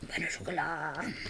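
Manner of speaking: whispered mock-French pan au chocolat; hushed, hammed-up French accent; silly French accent. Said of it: "in a stupid French accent".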